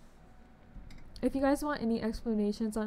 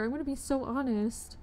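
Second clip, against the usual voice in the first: ordinary talk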